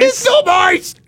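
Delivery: very high, loud, drawn-out squeal; mock panicked shout. Pest guy refusing to admit iguana, mock panicked shout. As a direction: insist in hammed-up desperate denial. Acted denial on seeing the iguana.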